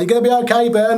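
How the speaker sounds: high, urgent reassurance; lightly voiced comrade calling out; reassuring buddy. Buddies reassuring him, retold.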